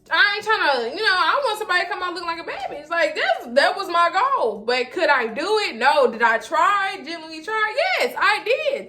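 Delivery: high, fast and worked up, protesting she really tried; excited insisting. Real, not acted. To her viewers, defending the hairdo, excited and high-pitched. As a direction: plead your case, voice up high.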